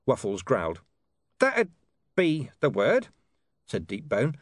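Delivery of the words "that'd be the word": high, gruff dog voice with a knowing air; a streetwise dog explaining things. Acted. Deep Bone speaking.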